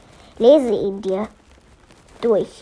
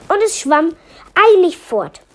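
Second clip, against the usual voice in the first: ordinary delivery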